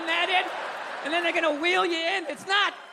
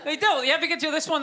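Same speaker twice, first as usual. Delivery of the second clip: ordinary talk, nothing marked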